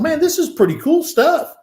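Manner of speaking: high-pitched retold enthusiasm; gleeful high quote of his younger self; excited. Retold delight while taking the test.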